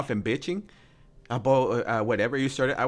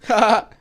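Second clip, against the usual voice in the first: short, loud, high laugh; real high burst of laughter; 11 semitones higher, 7 dB louder than usual. Real, not acted. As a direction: burst out with one sharp laugh. Laughing at the roommate's account.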